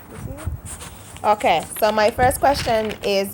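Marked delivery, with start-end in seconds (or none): 0.1-1.3 slow breathy whisper, rising. Real, not acted. Vlogger teases viewers about her paper, whispering. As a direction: whisper it playfully to the camera.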